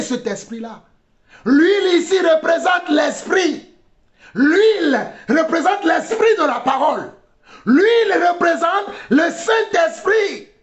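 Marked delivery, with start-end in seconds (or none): 1.4-10.5 high, ringing proclamation; fiery preacher's delivery; impassioned preaching. Real, not acted. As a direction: shout the teaching to the congregation. Preaches at full force.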